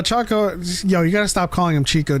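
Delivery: ordinary talk, nothing marked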